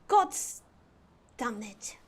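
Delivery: attempted cut-glass British English; amateur Queen's English, carefully enunciated; posh British accent.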